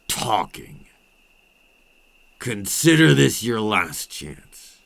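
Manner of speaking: rising from firm to a loud, menacing demand; building loud threat. Acted. Vampire pressing a captive to talk, swelling to a loud threat.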